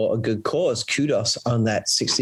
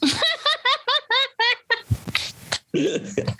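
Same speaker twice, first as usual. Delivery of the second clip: high-pitched laughing fit; high burst of laughter. Real, not acted. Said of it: "I got a laugh from you".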